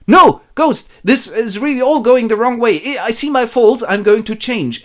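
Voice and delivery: high, loud frantic begging; panicked pleading. Acted. Scrooge begs the ghost at his grave, panicked. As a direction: cry no, then beg in a high voice.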